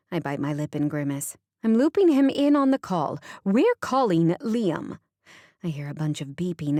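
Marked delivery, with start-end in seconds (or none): 1.6-5.0 fired up. Acted. Angry mother about to confront her son, forceful. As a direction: announce the call like a summons.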